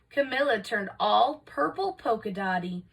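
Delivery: plain reading voice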